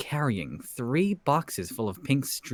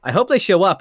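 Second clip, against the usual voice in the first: excited; 6 semitones higher, 10 dB louder than usual. Retold or imitated. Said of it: "she was like".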